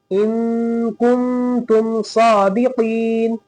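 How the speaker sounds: slow, drawn-out chanted recitation; Quran recitation. Shown as a demonstration. Teacher reciting for students, slow and melodic. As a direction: recite slowly, stretching each vowel.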